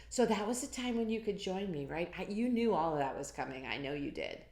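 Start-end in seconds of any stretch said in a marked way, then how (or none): none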